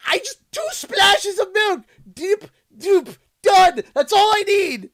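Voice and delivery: high and loud, nearly crying in frustration; exasperated whine. Real, not acted. Vlogger ranting to chat about the milk cup, high frustrated whine. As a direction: plead that two splashes is enough. Demanding just two splashes.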